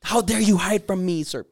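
high, loud indignant outburst; outraged fan. Acted. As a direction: confront them as a mock-furious fan. Acting an outraged crazed fan.

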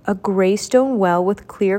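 usual speaking voice

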